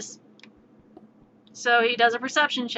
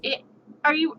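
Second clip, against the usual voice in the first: ordinary talk, nothing marked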